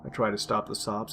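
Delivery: plain reading voice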